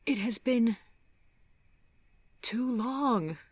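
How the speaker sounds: high, halting, tearful voice; pained, faltering regret; sorrowful refusal. A narrator's reading. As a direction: draw it out high, with a long pause. She sadly turns him down.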